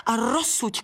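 high-pitched hail; calling out. Acted. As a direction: call the butterfly over, pitch up. Flower calls to passing butterfly. (zvolání na motýlka)

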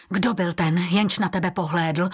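ordinary delivery, nothing marked

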